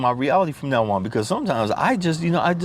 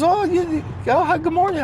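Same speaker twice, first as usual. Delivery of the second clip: much higher voice, surprised friendly hello; imitating the cashier. Imitating the drive-thru lady's surprised greeting, high voice. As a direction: play the startled cashier. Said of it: "She goes".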